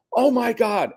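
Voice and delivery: high, delighted exclamation; high gasp of joy; overjoyed mom. Retold or imitated. Mom reacts to job news.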